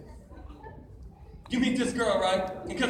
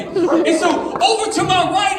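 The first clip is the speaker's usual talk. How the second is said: normal talking voice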